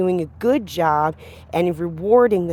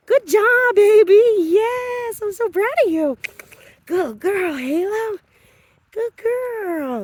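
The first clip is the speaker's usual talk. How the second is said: much higher, loud proud praise; 14 semitones higher, 4 dB louder, slower than usual. Real, not acted. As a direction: gush with pride over the dog. Praising the dog on walk.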